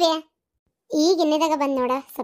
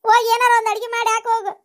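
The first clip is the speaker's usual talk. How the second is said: tearful plea; 6 semitones higher than usual. Acted.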